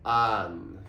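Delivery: "An" with a wide open ah, jaw dropped; open A vowel. Shown as a demonstration. Teacher modelling the open vowel in "An" for learners. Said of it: "Nice and open on that A vowel".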